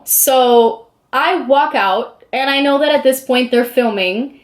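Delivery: ordinary talk, nothing marked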